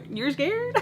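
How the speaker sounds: high-pitched teasing question; mock disbelief. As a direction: taunt him through amusement. Laughingly mocks his being scared.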